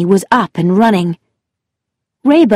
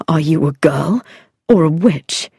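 hushed, near-whispered question; quietly; 4 semitones lower than usual. A narrator's reading. Rayburn half-whispers to Anne in the dark, hushed. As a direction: breathe it out in awe. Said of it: "he said quietly".

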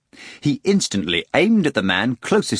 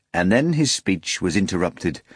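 ordinary delivery

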